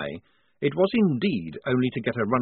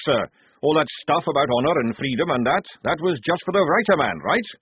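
raised, louder sergeant's voice, pleading sense; gruff old sergeant, protesting; 7 semitones higher, 4 dB louder than usual. A narrator's reading. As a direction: talk the officer out of it. Jackram protesting to Blouse.

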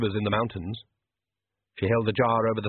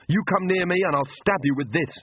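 higher, defiant threat; shouting threat; 8 semitones higher than usual. Acted. Magrat threatening the Count while clutching the baby, defiant. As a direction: stand her ground and threaten. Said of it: "she shouted".

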